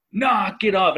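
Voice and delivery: high mock dad yell; retold parental yell. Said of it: "and I yelled it".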